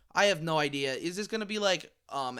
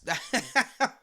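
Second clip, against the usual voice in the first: burst of laughter; 6 semitones higher than usual. Real, not acted. Laughing at own joke.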